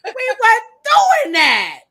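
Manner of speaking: high, loud, slow shout through laughter; loud laughing holler. Real, not acted. Laughing protest.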